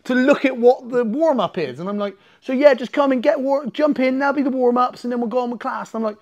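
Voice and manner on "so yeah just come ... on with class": mock upbeat coach. Imitating the coach again.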